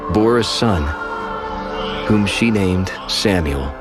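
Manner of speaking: ordinary talk, nothing marked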